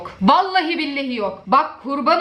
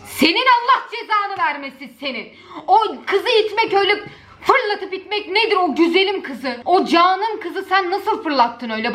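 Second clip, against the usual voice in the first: mock outrage, raised; 6 semitones higher than usual. Scolding the boy in video.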